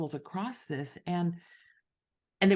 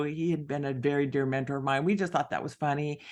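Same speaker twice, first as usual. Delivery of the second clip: usual speaking voice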